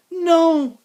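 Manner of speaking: put-on very loud, high girl's scream; screamed protest. A narrator's reading. Girl protesting as boys strip her rabbit, screamed. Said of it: "screamed Abilene".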